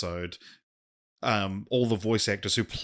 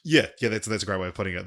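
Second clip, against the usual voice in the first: usual speaking voice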